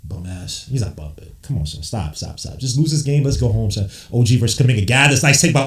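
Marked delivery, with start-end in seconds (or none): none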